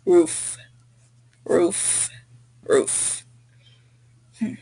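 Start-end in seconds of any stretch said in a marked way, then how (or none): 0.1-3.2 "roof" said alone, falling, with pauses between; pronunciation demo. Shown as a demonstration. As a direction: model "roof." slowly as a separate word for a learner. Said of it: "the word is pronounced".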